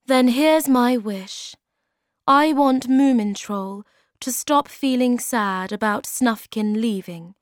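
a mother wishing for her son; tender Moomin Mama. Acted. Moomin Mama's wish.

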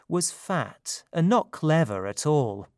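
ordinary delivery, nothing marked

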